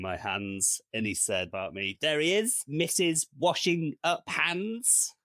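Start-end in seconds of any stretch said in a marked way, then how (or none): 2.0-5.1 high, loud, teasing announcement; jokey coworker imitation. Coworker commenting on someone washing hands, loud hammed-up teasing. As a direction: sing out a corny joke, stretching the nickname. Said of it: "trying to make a funny comment".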